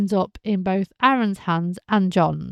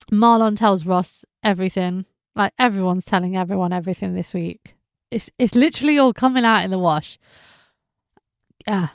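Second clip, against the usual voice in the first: ordinary talk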